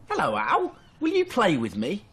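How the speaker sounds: high, eager cub voice; little fox. Acted. Little fox asking Owl to play, high and eager. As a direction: call up brightly to a friend. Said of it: "asked Fox".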